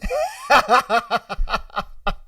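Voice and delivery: long, high laugh. Real, not acted. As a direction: crack up for real. Cracks up at his own joke.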